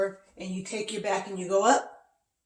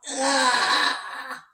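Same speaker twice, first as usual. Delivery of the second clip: loud, raised "aah" as the back catches; mock pain cry; 5 semitones higher, 6 dB louder than usual. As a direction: cry out a held "ah" of imitated pain.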